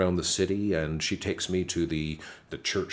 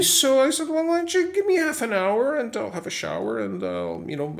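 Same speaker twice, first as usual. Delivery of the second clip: much higher, rushed flustered plea; high-pitched flustered stalling; 5 dB louder than usual. Retold or imitated. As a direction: scramble for time, caught off guard.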